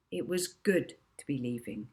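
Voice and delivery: usual speaking voice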